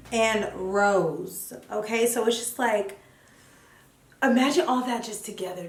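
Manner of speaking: normal talking voice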